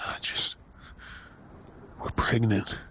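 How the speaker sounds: hushed awe. Acted. Awestruck at the news.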